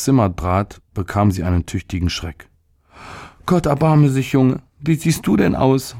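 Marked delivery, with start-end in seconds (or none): 3.4-6.0 high, alarmed mother crying out; a mother startled by her sick son; frightened mother's high exclamation; shocked mother. A narrator's reading. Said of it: "rief sie".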